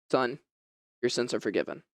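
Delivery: a hint of a gentle, solemn Jesus. Jesus speaks to the paralytic.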